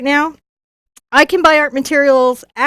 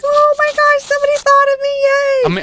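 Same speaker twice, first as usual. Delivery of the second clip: very high mock fangirl squeal; retold giddy squeal; 13 semitones higher than usual. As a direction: re-enact your delight in a tiny high voice. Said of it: "And I was like".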